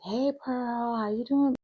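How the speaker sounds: high, cheery customer-service voice; chirpy high caller greeting; title company agent. Retold or imitated. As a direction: put on a sweet phone-agent voice. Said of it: "they're like".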